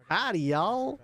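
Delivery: put-on playful Southern accent; Texas drawl. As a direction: greet them like a hammed-up Texan. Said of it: "you could do that Texas drawl".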